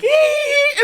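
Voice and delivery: very high drawn-out group squeal, imitated; mock squeal. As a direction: imitate tipsy women squealing in delight. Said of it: "And they all, like".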